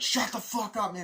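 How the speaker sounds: high, heated shout back; mock furious comeback; acted angry retort. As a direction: imitate a soldier snapping at his accuser. Acting quarrel between soldiers.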